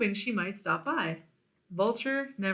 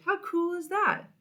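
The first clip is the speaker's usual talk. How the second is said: high, gushing brag; a character bragging about his ostrich friend, gushing; excited boast; 10 semitones higher than usual. Acted. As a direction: show off with a big high lift.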